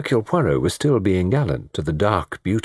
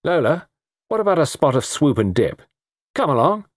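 breezy, higher-pitched invitation; sudden; 8 semitones higher than usual. Acted. Tony suddenly asking Lola to dance, breezy. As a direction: pipe up abruptly, chivvying her along. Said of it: "said suddenly".

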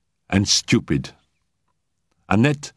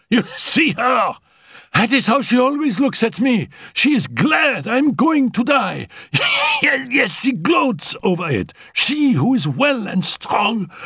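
shrill fury. Acted. Sick Annette rages at Félicie, shouting high. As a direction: spit it at the maid with hatred.

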